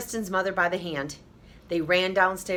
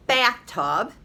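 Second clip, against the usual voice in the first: usual speaking voice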